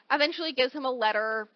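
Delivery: normal talking voice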